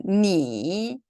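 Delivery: 니 drawn out, dipping then rising; word reading in tone. Shown as a demonstration. Teacher reading a word for students, dipping 니. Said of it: "제가 한번 읽어볼게요".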